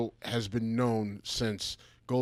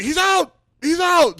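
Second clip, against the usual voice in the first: high, loud gleeful yelling; excited shout; 11 dB louder than usual. Retold or imitated. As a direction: shout the news with glee. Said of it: "I just like".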